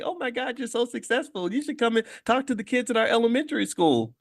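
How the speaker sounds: hammed-up admiration, pitched well up; gushing admirer. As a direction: gush in a small high voice, imitating a starstruck fan. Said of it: "make people admire that person and say".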